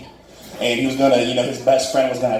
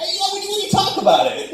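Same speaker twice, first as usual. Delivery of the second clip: flustered bluff; faster than usual. Retold or imitated. Acts out flustered answer to the guy.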